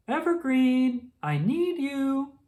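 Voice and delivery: high, slow call from another room; Mama calling her daughter from the kitchen. A narrator's reading. Mother calls from the kitchen, high and drawn out. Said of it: "called Mama from the kitchen".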